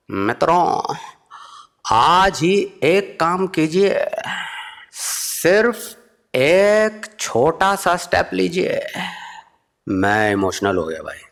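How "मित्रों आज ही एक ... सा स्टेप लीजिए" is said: very slow, higher motivational oration; Modi speech voice. Retold or imitated. Modi's last motivational appeal, in slow oration. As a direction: appeal like a national address. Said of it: "Modi जी ने फिर last try की".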